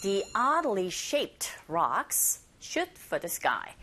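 each word slowly and clearly; slow model sentence. Shown as a demonstration. Teacher models the sentence slowly for students to copy. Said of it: "pronunciation practicing time".

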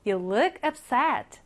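slow, clear repeat; slow model reading. Shown as a demonstration. Teacher repeating the example slowly. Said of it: "너는 속상해 보인다".

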